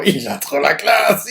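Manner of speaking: laughs through the words, slow; laughing gush. Real, not acted. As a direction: chuckle out your admiration, unhurried. Gushing over the character.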